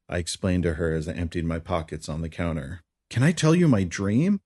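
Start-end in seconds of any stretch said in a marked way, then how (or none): 3.1-4.4 lifted, eager question; joyful. Acted. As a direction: ask with childlike joy. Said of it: "with a noticeable joy in her voice".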